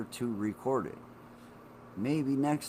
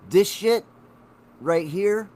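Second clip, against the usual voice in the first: proud emphasis; 6 semitones higher, 6 dB louder, slower than usual. Real, not acted. Pointing proudly at his nose.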